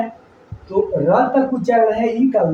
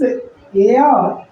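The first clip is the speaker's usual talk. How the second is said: slow teaching. Shown as a demonstration. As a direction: drag out ए अ very slowly. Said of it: "ए को ए अ पढ़ते हैं".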